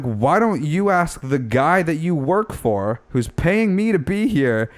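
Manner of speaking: slow, high-pitched retold comeback; pointed comeback.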